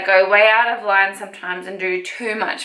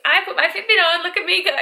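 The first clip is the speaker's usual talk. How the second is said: proud excited; 7 semitones higher, faster than usual. Retold or imitated. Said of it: "I was like".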